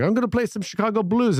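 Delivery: lightly imitated guitar showman, pitched high; mock stage announcement. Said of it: "He's like".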